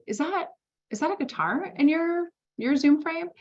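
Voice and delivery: high, curious question rising up; telling a client she spots a guitar, high curious surprise. Retold or imitated. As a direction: lean in and ask, intrigued.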